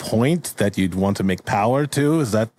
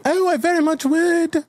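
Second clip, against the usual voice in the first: falsetto flirty lady, much higher. Acted. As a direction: coo back like an eager maiden. Lady answering Shakespeare's offer.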